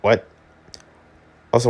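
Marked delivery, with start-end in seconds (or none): none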